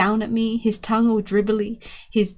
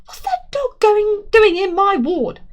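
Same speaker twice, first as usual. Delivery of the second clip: high, loud furious demand; angry; 12 semitones higher, 6 dB louder than usual. A narrator's reading. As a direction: storm in and demand answers. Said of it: "Very angry looking lady".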